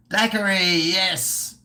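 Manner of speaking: high, elated exclamation; triumphant. Real, not acted. Streamer finally getting the forgotten drink name, triumphant. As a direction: cry it out as the answer finally lands.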